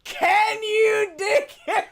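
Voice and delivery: high, slow, laughing echo; laughing imitation. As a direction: echo the line through laughter. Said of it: "that was great".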